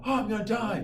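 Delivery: high, melodramatic doom; mock dying wail. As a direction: whine it like a hammed-up goner. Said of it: "You know".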